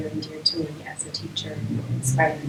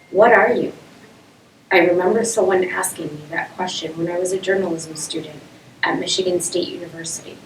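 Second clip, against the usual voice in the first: projected narrative-poem recital; poem reading; 10 dB louder, faster than usual. Acted. Poet telling an audience of being an outsider, projected recital. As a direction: read it out firmly, line by line.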